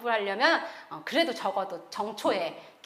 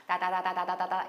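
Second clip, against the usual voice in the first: put-on baby tongues; 6 semitones lower, 5 dB quieter than usual. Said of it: "애기 방언이네".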